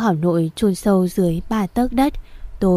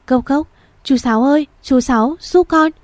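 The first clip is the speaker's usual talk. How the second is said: urgent call through a door, rising louder; 6 semitones higher, 3 dB louder than usual. Acted. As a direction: shout for help at the door. Girl knocking, calling neighbour. (gọi to cầu cứu)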